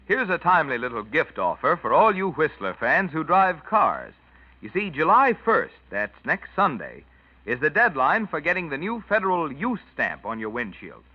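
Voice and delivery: ordinary talk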